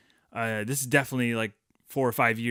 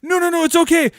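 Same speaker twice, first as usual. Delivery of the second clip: much higher and louder, hurried pleading; frantic reassuring dad; 10 dB louder, faster than usual. Retold or imitated. Dad chasing his scared son, loud frantic reassurance. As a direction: call after him, trying to calm him.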